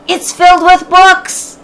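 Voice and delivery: excited call. Acted. Annie calls down slowly.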